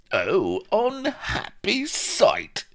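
very high, slow squeaky wail; high-pitched sidekick in dismay; exclaiming Vladimir. A narrator's reading. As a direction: lament it in a drawn-out squeak. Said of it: "exclaimed Vladimir".

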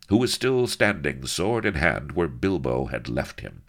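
usual speaking voice

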